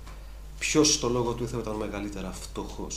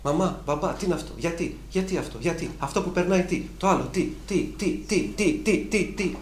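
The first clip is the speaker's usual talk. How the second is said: put-on higher-pitched child firing questions; imitating a questioning child; curious child; 6 semitones higher than usual. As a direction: play a small kid nagging why. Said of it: "Για θυμηθείτε τα μικρά παιδιά".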